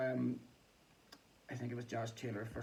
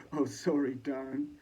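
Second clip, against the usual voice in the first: mock dismissive; 8 dB louder than usual. Repeating Davison's dismissive line.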